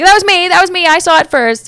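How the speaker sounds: high, loud glory-grabbing claim; mock-boastful shout, pitched high; Columbus. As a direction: butt in loudly to claim the credit. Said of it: "and Columbus was like".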